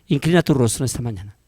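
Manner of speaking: soft altar-call invitation; gentle invitation. Real, not acted. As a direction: hush the room into prayer. Invites congregation to bow.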